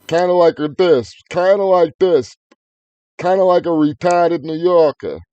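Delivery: high, loud mock New York accent. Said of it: "Talks like a fucking New Yorker".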